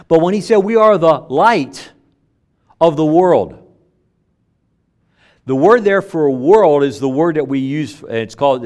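loud, rising sermon climax; preaching at full force. Acted. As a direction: preach it out to the congregation.

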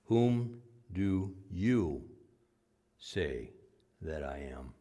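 very slow, sinking to a hush; slow emphatic question. Shown as a demonstration. Preacher slows to press the question on his flock. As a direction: draw out each word of the question. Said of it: "could be written this way".

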